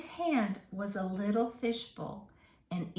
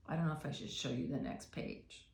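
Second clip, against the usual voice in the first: lower, softer and quick; hushed aside; 7 semitones lower, 9 dB quieter, faster than usual. A narrator's reading. Reader teasing children about the next page. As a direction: tease the children softly. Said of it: "teasing reader aside".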